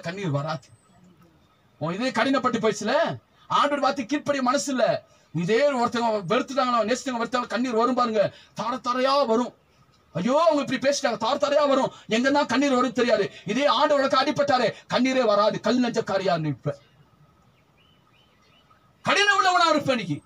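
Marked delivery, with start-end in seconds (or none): 1.8-16.8 fiery preaching. Acted. Preacher pressing the church about tears, full-force preaching. 19.1-19.9 much higher, louder shouted rebuke; preacher's shout. Acted. Preacher rebuking stony hearts, shouting.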